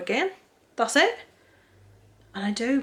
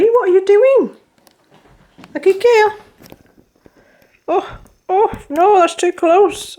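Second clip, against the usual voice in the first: high, louder dog-talk coo; pet voice; 10 semitones higher, 7 dB louder than usual. Real, not acted. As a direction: owner cooing at her dog. Cooing at her dog Molly.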